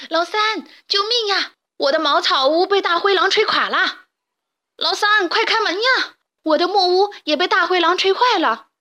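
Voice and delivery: terrified pigs shouting to their brother; panicked cries. Acted. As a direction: scream for help at the door. Pigs screaming for help.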